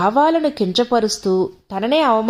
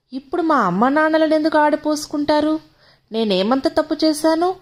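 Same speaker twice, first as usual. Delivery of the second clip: higher, hesitant wife's question; a timid wife finally asking, higher and hesitant; timid, gathering courage; 6 semitones higher than usual. A narrator's reading. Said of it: "మెల్లగా ధైర్యాన్ని కూడగొట్టుకుని అడిగింది".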